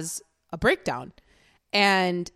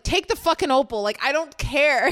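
higher, louder fed-up snap; exasperated uncle; 6 semitones higher, 4 dB louder than usual. Retold or imitated. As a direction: hand it over in mock disgust. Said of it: "And his uncle's like".

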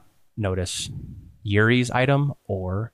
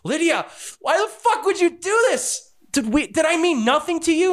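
betrayed cry. Acted. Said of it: "she cries out".

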